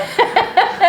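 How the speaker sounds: quick high giggle; high laugh. Real, not acted. Self-conscious laugh about her gray hair. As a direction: laugh it off lightly.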